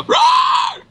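sudden high rasping roar, all breath; jump-scare roar at the garage door; the dad's fake monster roar; mock monster roar. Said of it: "Like that".

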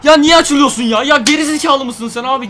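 high, loud exasperated shouting at the goalkeeper; furious yelling. Real, not acted. Gamer berating his goalkeeper Casillas for a blunder, furious yelling. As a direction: tear into him for the blunder.

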